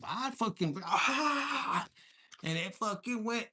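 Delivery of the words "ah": high, drawn-out "Ah!"; imitating a loud man's cry; loud imitated yell. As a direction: let out a long mock holler. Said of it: "he's loud".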